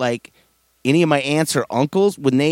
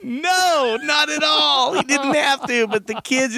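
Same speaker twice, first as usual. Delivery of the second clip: ordinary talk, nothing marked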